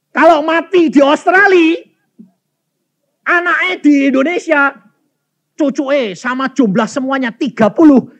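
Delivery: high, forceful arguing with God; retold indignant objection; loud protest. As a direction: plead the case loudly, pitched high.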